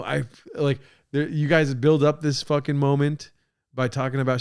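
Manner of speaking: normal talking voice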